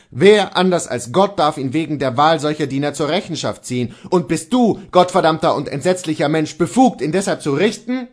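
indignant Luther. Acted. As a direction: rage at his madness.

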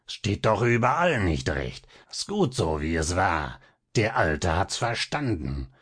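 lightly voiced hiss and lisp of a villain; muttering to himself over his work; a touch of a lisping sinister tinkerer. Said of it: "So zischte und lispelte Coppelius".

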